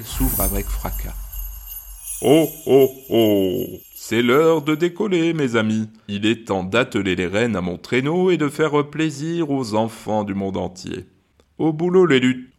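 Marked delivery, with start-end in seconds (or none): 2.2-12.5 loud booming Santa; Santa's ho ho ho. A narrator's reading. Santa calling the elves to work, loud and jolly. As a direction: boom it out like Father Christmas. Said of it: "s'étonne le Père Noël".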